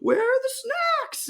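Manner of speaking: high, drawn-out sing-song question; high, slow, playful ask for snacks; silly demand. Retold or imitated. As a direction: whine it like a kid at a party. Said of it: "We're like".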